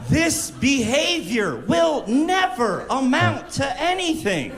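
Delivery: high, pleading scold; exasperated teacher. Retold or imitated. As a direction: beg the kid to stop, worn out.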